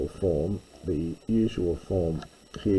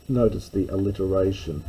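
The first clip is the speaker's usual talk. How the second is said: usual speaking voice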